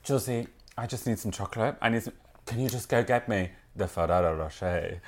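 low, quiet man's voice; imitated partner. Man at home asking for chocolate, imitated low and quiet. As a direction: drop low and soft, imitating him. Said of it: "And he was like".

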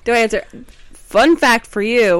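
ordinary talk

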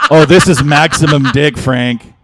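hammed-up porn actress; overacted bedroom exclamation; mock porn moan. Acting a porn performer.

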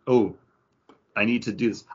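ordinary talk

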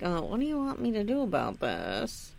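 soft, offhand mock preacher; dismissive preacher. Preacher brushing off the father, soft and offhand. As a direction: shrug it off, lightly voiced. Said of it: "the preacher's like".

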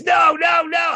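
high, loud cry of no; panicked shout. Retold or imitated. Man on the phone cries out as the threat cuts off, loud and high. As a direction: yelp it in panic, pitch way up. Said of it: "you just say this".

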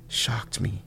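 hushed, stunned whisper; whispered shock. A narrator's reading. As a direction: let the words drop to a whisper. Narrator sees the blood.

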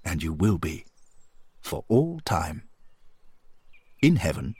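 honour a friend with measured, swooping emphasis; earnest, encouraging companion. A narrator's reading. Voices Henry's tribute to Johnny.

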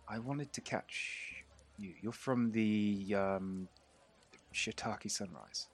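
very quiet, slow and halting; hesitant half-orc stranger. Acted. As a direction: feel your way softly through it. Game master voices hesitant half-orc stranger.

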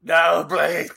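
loud mock-pleading call; sad shout. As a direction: beg them back in a hammed-up cry. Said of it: "shouted Draco sadly".

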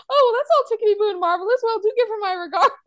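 high, rushed, falsely breezy imitation; failing to sound cool. Man hiding his interest from Trixie, high and rushed fake-cool. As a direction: blurt it brightly, pretending not to care. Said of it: "just trying to be so cool and just so not being cool".

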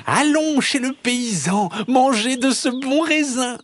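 high, singing-out fox voice; gleeful high-pitched invitation; eager fox. A narrator's reading. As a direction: entice a friend along, pitched high. Fox eagerly tempts his friend.

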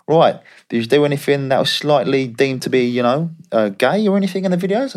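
cheery Aussie agent checking in on his player; Australian agent impression. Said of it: "He goes".

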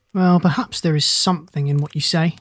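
ordinary delivery, nothing marked

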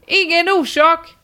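high-pitched called-out courtesy; mock shout. Poster answers neighbour's thanks from window, mock shout. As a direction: holler politely, as if from a window.

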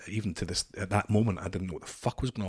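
ordinary talk, nothing marked

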